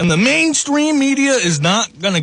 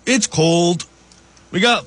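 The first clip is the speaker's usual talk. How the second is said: ordinary talk, nothing marked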